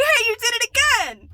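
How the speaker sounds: high, loud mock outrage; mock indignant yell from a Vine sketch. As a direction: act out the sketch character's outrage. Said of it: "he's like".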